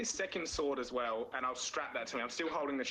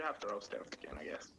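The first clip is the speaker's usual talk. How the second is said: whispered aside; 6 semitones lower, 6 dB quieter than usual. Real, not acted. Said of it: "whispered aside".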